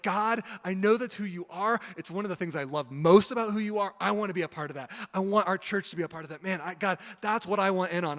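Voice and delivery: retold impassioned prayer; earnest plea. Impassioned prayer acted in story.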